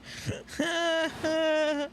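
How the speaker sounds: high-pitched giggling fit; high giggle. Real, not acted. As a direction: burst into a long squeaky giggle. Streamer cracks up at game.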